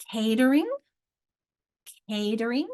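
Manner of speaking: "Catering" with weight on CA; "Catering" as CA-ter-ing; first-syllable stress. Shown as a demonstration. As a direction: hit CA in "catering". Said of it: "my strong".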